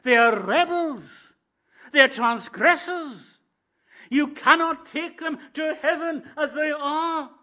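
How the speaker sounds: the law's accusation. Acted. The law telling the Lord Jesus he cannot have them, stern and loud. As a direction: pronounce judgement like a stern judge. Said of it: "says the law".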